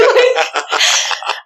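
airy high-pitched laugh, no voice; breathy high laugh. Real, not acted. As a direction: let out a high breathless giggle. Laughs at own retort.

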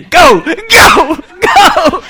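high, hooting call; acted shout. Preacher playing the candy man, high comic shout. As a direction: call it out high, laughing through it.

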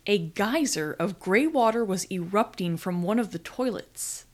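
plain reading voice

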